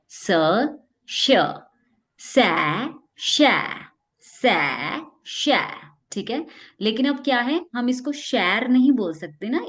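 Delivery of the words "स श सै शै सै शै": alternating स and शै sounds; s-sh drill. Shown as a demonstration. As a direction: drill सै against शै. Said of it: "तो देखिए".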